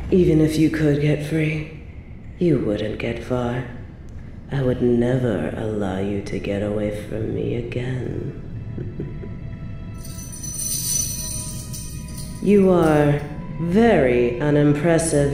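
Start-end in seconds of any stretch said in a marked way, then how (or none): 2.4-8.4 lower, softer threat; quiet menace. Acted. Queen to captive rebel, quietly menacing. Captor threatening bound prisoner. 8.5-12.3 soft, breathy gloating laugh; sinister chuckle. Acted. Queen gloating over her captive, hushed sinister chuckle.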